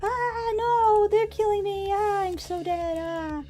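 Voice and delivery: very high, strained wailing that stretches slowly and fades out; hammed-up dying wail. Streamer voices Timmy wailing as he dies, hammed up. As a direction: wail like a cartoon victim breathing his last. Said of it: "It was".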